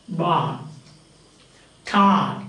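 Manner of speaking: "Bar", "Tar" ending in long open vowels; silent final R. Shown as a demonstration. Teacher showing R is not realized at word end, silent R. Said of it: "never pronounced at the end of".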